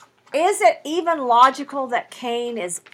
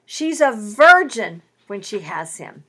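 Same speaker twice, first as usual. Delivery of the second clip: normal talking voice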